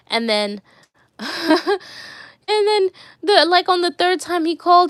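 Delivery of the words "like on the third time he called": ordinary talk, nothing marked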